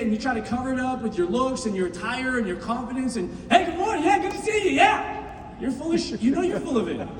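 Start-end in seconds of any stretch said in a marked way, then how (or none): 3.5-5.6 fake cheer. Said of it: "you try to fake it".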